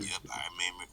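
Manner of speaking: ordinary talk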